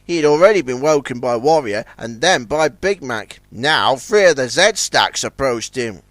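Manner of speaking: plain reading voice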